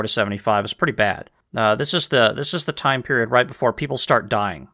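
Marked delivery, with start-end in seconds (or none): none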